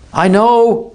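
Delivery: high, loud shout with a ragged edge. Acted. Artemis shouting up to Holly, ragged and loud. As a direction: yell it back ragged. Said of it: "he shouted, a ragged edge".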